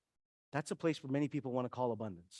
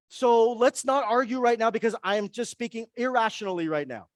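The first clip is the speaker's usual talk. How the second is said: louder, higher, heated but controlled; angry self-talk; 8 semitones higher, 8 dB louder than usual. Retold or imitated. Telling girlfriend mid-argument, loud and heated. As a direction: talk yourself down while still angry. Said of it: "I'll be, like, super angry. I'm like".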